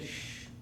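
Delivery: very soft shush; Jesus. Acted. Jesus quieting the angry sea, soft shush. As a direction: hush it gently, barely audible. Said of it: "Or maybe he said".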